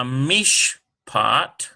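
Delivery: Mishpat drawn out syllable by syllable, slow; sounding out. Shown as a demonstration. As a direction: sound out Mish... pat with a gap. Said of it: "We'll put it on the P sound".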